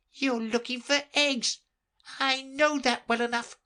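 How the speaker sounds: very high, fussy bird voice; fretful pigeon. Acted. The pigeon grumbling about serpents, very high fussy voice. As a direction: complain like a harried mother bird.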